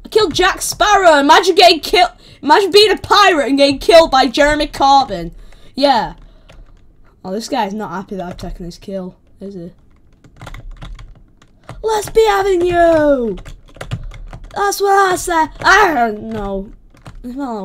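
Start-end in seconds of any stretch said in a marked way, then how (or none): 0.0-6.2 loud, excited crowing; raised, animated gloat; gleeful boasting. Real, not acted. Gloating over a kill. 11.8-15.9 high, loud holler; shouted challenge; rowdy battle cry. Real, not acted. Taunting opponents in battle.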